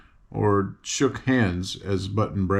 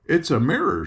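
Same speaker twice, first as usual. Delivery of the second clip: bright, higher cry of delight; 5 semitones higher than usual. A narrator's reading. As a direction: gasp out the surprise of seeing herself. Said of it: "she cried".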